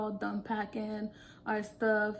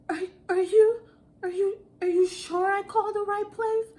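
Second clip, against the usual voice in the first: high, louder, anxious imitated question; shaky caller; 9 semitones higher, 6 dB louder than usual. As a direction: mimic the scared customer pleading for reassurance. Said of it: "her voice sounded so shaky".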